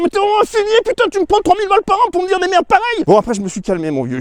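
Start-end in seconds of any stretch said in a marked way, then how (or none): 0.0-3.0 high, strained outraged yell at the accountant; hammed-up outrage at a useless accountant; mock furious rant. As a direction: blow up at him in mock fury. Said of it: "de dire".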